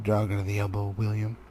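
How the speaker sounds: plain reading voice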